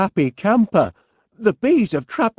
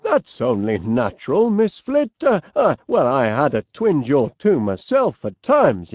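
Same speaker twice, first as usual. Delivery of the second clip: ordinary talk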